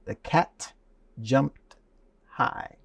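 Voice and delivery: word by word, pauses after "cat" and "jumped"; careful diction. Shown as a demonstration. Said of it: "The D will say diction".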